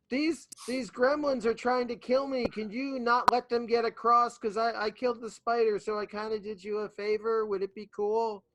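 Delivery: high, sustained pleading call; high-voiced request called upward; calling up to giant. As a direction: keep it pitched high so the giant hears. Player pleads with towering giant.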